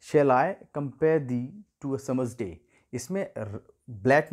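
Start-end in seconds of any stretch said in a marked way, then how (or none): 0.1-2.5 slow, measured iambic reading, da-DUM; reading the meter. Shown as a demonstration. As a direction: read it out slowly, leaning on each stressed beat. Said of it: "जैसे यहाँ पर देख सकते हैं". (धीमी, लयबद्ध आयैम्बिक पढ़ाई)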